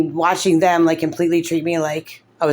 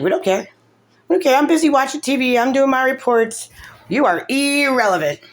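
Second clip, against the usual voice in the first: higher-pitched mocking imitation; mock dismissive; 7 semitones higher than usual. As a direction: brush them off in a high mimicking voice. Mocking the caseworkers' dismissive answer.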